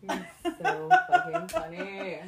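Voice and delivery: slow, high laughing words; amused laughing praise. Real, not acted. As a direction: say it while laughing hard. Cracking up at friend's joke.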